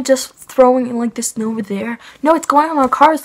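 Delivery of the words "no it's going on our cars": high, louder insistent no; imitating an angry neighbour-quarrel protest; retold protest. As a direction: push back sharply, pitch up. Retold quarrel over snow.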